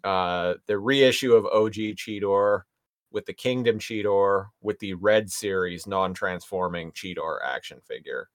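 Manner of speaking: ordinary talk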